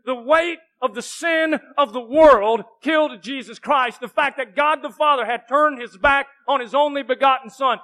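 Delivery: loud, rising sermon, words hammered home; preaching at full force. Acted. Pastor preaching to his church, loud and fervent. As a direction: shout the sacrifice to the congregation.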